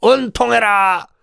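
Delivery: high, furious yelling; angry shout. Acted. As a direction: confront him and strike. Hang Woo attacks Eun Tong.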